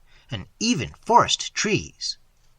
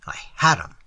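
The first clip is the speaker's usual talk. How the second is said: breathy gleeful whisper; 7 semitones lower, faster than usual. A narrator's reading. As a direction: whisper it to yourself, triumphant. Gloating inward triumph.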